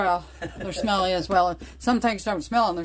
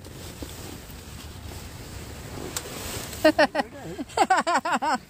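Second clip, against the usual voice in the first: soft breathy laugh; 7 semitones higher, 5 dB quieter than usual. Real, not acted. Laughing at the moon video.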